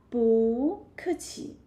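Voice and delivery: 不客气 slowly, with 不 sliding upward; rising tone on 不. Shown as a demonstration. Teacher repeats the changed tone, 不 rising in 不客气. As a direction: draw out 不 as a rise, then 客气. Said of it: "it changes to rising tone".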